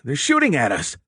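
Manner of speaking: sailor yelling a warning, pitched high; alarmed cry. A narrator's reading. Sailor warning the crew of gunfire, alarmed yell. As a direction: cry out that they're under fire. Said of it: "A sailor cried".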